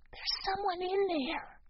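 slow, high hushed whisper. A narrator's reading. Boy with his ear to the door, whispering. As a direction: whisper it softly in a high child's voice. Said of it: "he whispered".

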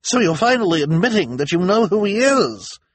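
high, loud cry of triumph; triumphant. A narrator's reading. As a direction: pounce on it loudly, as if catching them out. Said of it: "she cried triumphantly".

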